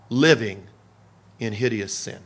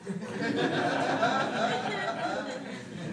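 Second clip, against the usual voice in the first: rising laugh; 11 semitones higher, 8 dB quieter than usual. Real, not acted. Laughing at his own story.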